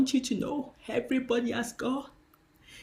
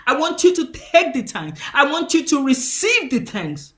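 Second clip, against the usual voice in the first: louder, insistent voice of God; God's firm reply; 4 semitones higher, 10 dB louder than usual. Retold or imitated. Pastor voicing God correcting her, loud and insistent. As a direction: press the thanks on her. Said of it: "And God said".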